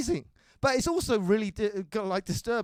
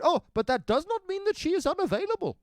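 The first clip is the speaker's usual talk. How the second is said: put-on higher, lightly Swedish-accented clarification; imitated Swede; 5 semitones higher, 4 dB quieter than usual. Promoter about his girlfriend, imitated Swedish accent. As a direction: correct yourself in a mock Swedish voice.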